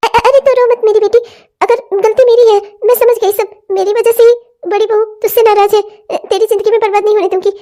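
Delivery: a mother begging forgiveness of her daughter-in-law; remorseful old mother. Acted. Voices old mother role. (पछताती हुई बूढ़ी माँ)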